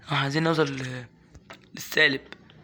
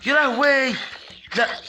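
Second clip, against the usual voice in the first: alarmed cry; 8 semitones higher, slower than usual. Real, not acted. Gamer startled by sudden danger, high alarmed cry. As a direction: gasp it out in alarm.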